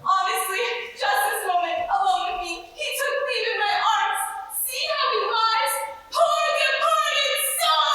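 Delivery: milk the death scene; fake mourning. Maid staging a fake death for the wife, high sing-song wailing. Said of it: "broken-hearted lamentations".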